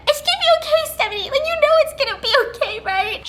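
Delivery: very high mock-crying voice; hammed-up tearful pleading for reassurance; imitating bawling. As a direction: squeak it out like a sobbing sister. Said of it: "She just was bawling her eyes out".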